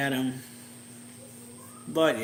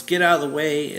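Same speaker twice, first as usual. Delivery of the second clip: a loud, raised-pitch yell to clear the way; 4 semitones higher, 10 dB louder than usual. Retold or imitated. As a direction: shout it so they move. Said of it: "Futaba yells".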